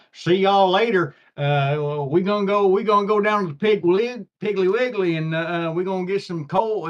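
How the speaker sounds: put-on goof around in a folksy Southern US accent. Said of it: "But nobody wrote in accent back then".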